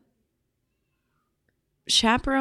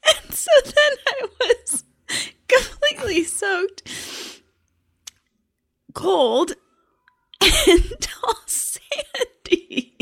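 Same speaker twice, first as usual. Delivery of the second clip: laugh-crying; 10 semitones higher, 4 dB louder than usual. Real, not acted. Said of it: "I can't stop crying now".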